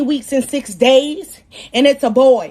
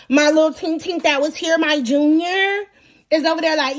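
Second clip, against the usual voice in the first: normal talking voice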